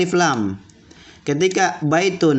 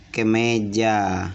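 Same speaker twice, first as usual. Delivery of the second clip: usual speaking voice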